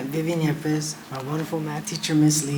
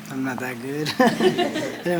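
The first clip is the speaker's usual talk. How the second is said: nervous laugh through the words; a student laughing off his nerves; slower than usual. Real, not acted. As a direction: chuckle sheepishly while owning up. Nervous student admits unmemorized speech.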